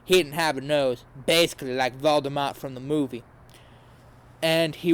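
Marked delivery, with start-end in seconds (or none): none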